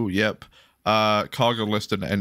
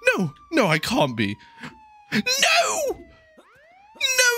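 panicked cry. Real, not acted. Van stuck on the barrier, crying out in panic.

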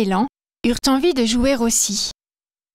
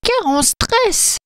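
Madame Reine, pitched high and slow; a small anxious reindeer voice, drawn out; 10 semitones higher, slower than usual. Acted. Reindeer explains her worry to the fairy, high and slow.

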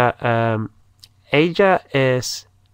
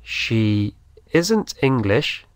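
She isn't English said slowly; slow, clear dictation pace; slow model; slower than usual. Shown as a demonstration. Teacher dictates slowly to Spanish learners. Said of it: "Ella no es inglesa".

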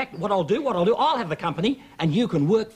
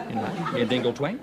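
ordinary delivery

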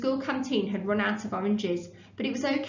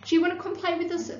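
high, fast, eager call to a new friend; boy eagerly inviting the new boy to football; excited shout; 7 semitones higher, faster than usual. A narrator's reading. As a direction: blurt it out, much higher and quicker. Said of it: "shouted Tom".

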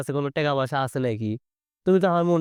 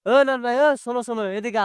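yelling across the room; 7 semitones higher than usual. Acted. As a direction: shout after him to stop. Calling the friend back.

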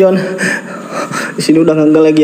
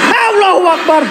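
very high frightened outburst; startled cry. Real, not acted. Frightened ghost hunter calls on God, high startled cry. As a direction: cry out in sudden fright, pitch leaping.

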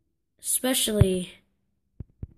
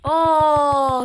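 long, loud, high "oh"; dismayed cry; 7 semitones higher, 10 dB louder than usual. Real, not acted. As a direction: wail it out on falling. Fell in the same place.

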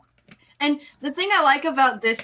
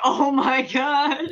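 exasperated; 5 semitones higher, much slower than usual. Real, not acted. Exasperated at heroine.

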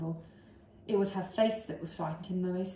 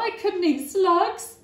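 disgusted cry; 12 semitones higher, 10 dB louder, slower than usual. Acted. Boy protests in disgust.

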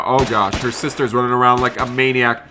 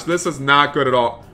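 normal talking voice

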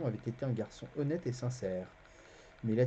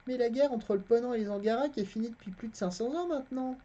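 put-on a boy's voice, pitched higher; the narrator voicing the boy Garion, higher; boy lying innocently to a stranger, higher voice; young boy Garion; 12 semitones higher, 4 dB louder than usual. A narrator's reading.

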